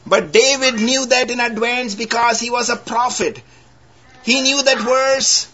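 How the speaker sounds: high, ringing preacher's voice; fervent pulpit delivery; preaching. Acted. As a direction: lift it up to the congregation. Preaching about scripture.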